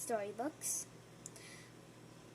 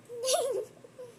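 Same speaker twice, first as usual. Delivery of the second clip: giggle; 11 semitones higher, 4 dB louder than usual. Acted. Penguin giggles.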